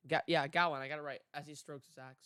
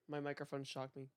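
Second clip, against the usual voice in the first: usual speaking voice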